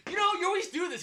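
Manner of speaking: high, loud shrieking complaint; nagging wife voice. Retold or imitated. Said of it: "she's like".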